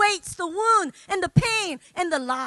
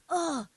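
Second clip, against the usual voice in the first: groan; 3 dB quieter than usual. Retold or imitated. Conviction hits in the moment.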